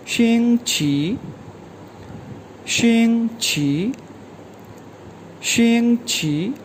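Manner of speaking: शिंग छी said slowly, each syllable drawn out with a rising tone; careful slow classroom repetition of शिंग छी; slow Chinese model word. Shown as a demonstration. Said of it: "कहा जाता है शिंग छी".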